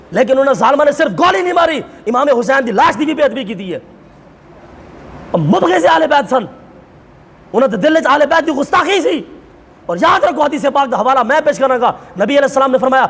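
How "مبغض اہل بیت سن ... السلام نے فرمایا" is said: ordinary delivery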